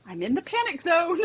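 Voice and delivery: high-pitched retold cry of alarm; mock panic. Client retelling how she flagged her panic to the group, high mock alarm. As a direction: pitch jumps up in re-enacted panic.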